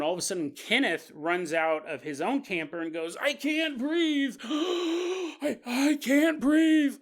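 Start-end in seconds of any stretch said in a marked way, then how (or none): 3.2-7.0 high, slow, gasping panic; panicked gasping. Retold or imitated. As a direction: wheeze out each word, fighting for air. Kenneth can't breathe, panicking.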